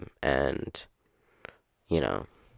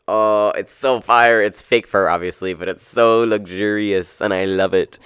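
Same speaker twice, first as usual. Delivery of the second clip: gushing; 4 semitones higher, 11 dB louder, slower than usual. Real, not acted. Gushing about her fur coat.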